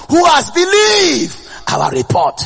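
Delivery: loud, slow, high proclamation; shouting out. Acted. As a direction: cry the question out to the crowd. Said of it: "started by shouting out".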